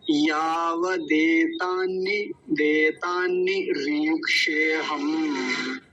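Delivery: "यावदेतान्नी" and "रीक्षे हम" slowly drawn out, m held; careful Sanskrit pronunciation. Shown as a demonstration. Teacher repeats the long word for students, slow careful demonstration. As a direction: sound out "देतान्नी रीक्षे हम" linked and slow. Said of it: "फिर से देखिए".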